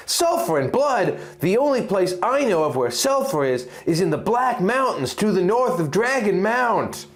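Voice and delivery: a hint of a worried husband thinking aloud; Link, alarmed. Link voiced in story.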